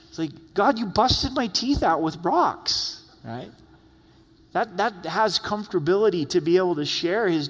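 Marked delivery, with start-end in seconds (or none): 0.5-3.0 mock complaint. Jeremiah paraphrased complaining to God.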